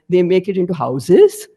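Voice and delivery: "houses" rising at the end to show the sentence goes on; unfinished-sentence rise. Shown as a demonstration. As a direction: lift the pitch on "houses", not done yet. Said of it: "But if your sentence is not over, like".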